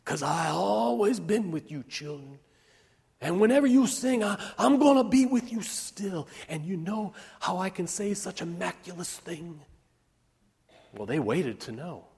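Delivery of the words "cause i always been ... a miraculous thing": high, impassioned character voice; fervent Odessa. A narrator's reading. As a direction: speak it with fierce conviction to children. Odessa's fervent speech to children.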